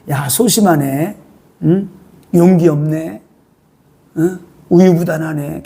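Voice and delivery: low, slow mocking taunt; taunting tempter. Satan taunting Eve as timid, low and slow. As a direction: needle her slowly from below.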